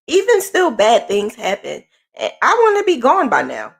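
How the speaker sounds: ordinary talk, nothing marked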